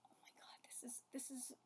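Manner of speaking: breathless, stunned whisper; awed whisper. Real, not acted. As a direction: whisper as if you can't believe the page. Awed by the manga page.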